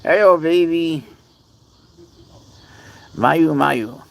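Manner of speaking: sweet pet greeting, drawn out. Real, not acted. Greeting cat in baby talk.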